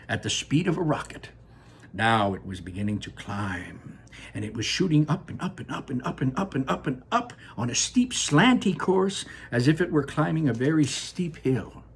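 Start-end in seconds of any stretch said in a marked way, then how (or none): none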